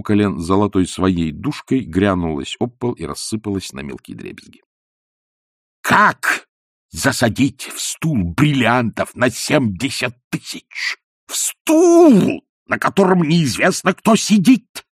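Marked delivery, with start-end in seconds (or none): 5.8-14.8 very high, loud, outraged yelling; raging shrieks. A narrator's reading. Ippolit screaming over the hidden diamonds, outraged. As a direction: rage at the madness of it.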